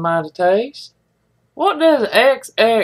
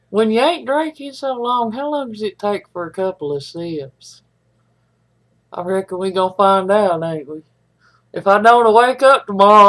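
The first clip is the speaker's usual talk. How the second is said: ordinary talk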